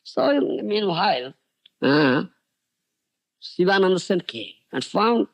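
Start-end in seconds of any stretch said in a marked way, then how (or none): none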